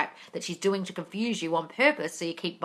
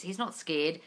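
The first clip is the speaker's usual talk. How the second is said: usual speaking voice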